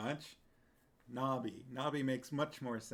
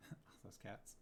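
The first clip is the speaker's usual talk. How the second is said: whispered; 4 semitones lower, 15 dB quieter than usual. Real, not acted. Seeing cats on screen.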